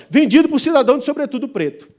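loud, high gavel announcement; auctioneer's call. Acted. Auctioneer closing the sale, proclaiming loudly.

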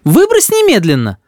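high-pitched indignant order; mother's cry. A narrator's reading. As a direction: order it out as an outraged mother, not full volume. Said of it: "закричала мама".

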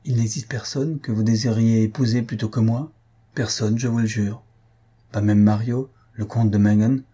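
ordinary delivery, nothing marked

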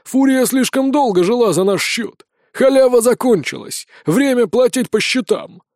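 rough, menacing henchman voice; hoarse bandit. A narrator's reading. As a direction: croak out the threat, emphatically. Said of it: "прохрипел второй голос".

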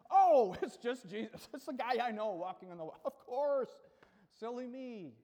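big loud "oh", then breezy sarcasm; mock relief. Preacher voices disciples recognising Jesus on water, mock relief. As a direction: play exaggerated, unconvinced relief with a chuckle. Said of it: "don't think that was quite enough".

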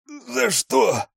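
high, winded cry of pain; pained moan. A narrator's reading. Rzhavy groans after a punch to the gut, high and pained. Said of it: "простонал он, задыхаясь от боли".

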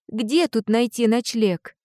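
plain reading voice

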